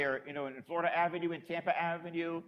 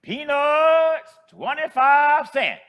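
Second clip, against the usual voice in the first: high, loud, drawn-out peddler's cry; loud, slow hawker's call down an empty sidewalk; street vendor's call; 9 semitones higher, 13 dB louder, much slower than usual. Retold or imitated. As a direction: call it out long and high like an old street seller. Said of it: "she yells out".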